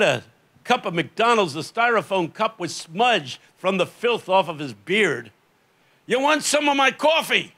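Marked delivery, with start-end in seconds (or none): 6.1-7.5 high-pitched call of a street man. Retold or imitated. Street man hails a passing preacher to offer coffee, high-pitched call. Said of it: "This filthy, dirty street man".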